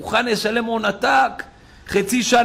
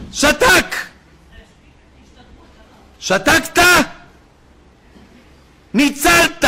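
high-pitched shouted bursts with long pauses; preacher's triumphant cry; emphatic proclamation; 8 semitones higher, 3 dB louder, slower than usual. Real, not acted. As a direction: fire out each word to the crowd. Preacher proclaims the lesson at climax.

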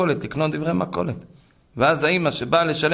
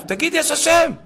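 high-pitched yelled order; shouted demand; 13 semitones higher, 4 dB louder than usual. Retold or imitated. Terrorist shouting at the captive to confess, yelled demand. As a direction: yell it, forcing him to say it. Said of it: "אז הוא צועק אליו".

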